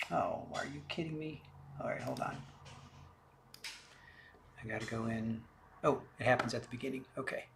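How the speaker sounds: quiet off-mic muttering to himself; muttered aside. Real, not acted. Host fiddling with the recording, muttering quietly to himself. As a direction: mutter under your breath while fixing the playback.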